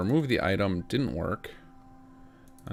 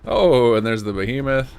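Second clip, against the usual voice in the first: startled; 4 semitones higher, 9 dB louder than usual. Real, not acted. Sees giant monster appear.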